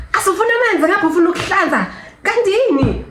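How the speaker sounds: high, fast mock scolding nurse. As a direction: rattle off the nurse's impatient scolding in a mocking voice. Nurse scolding her, retold.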